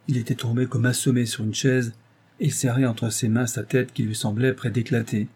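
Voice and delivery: ordinary delivery, nothing marked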